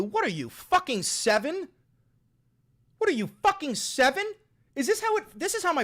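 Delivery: high, incredulous rising question; indignant, fed-up jab; exasperated scolding. Real, not acted. Scolding chatters for acting childish.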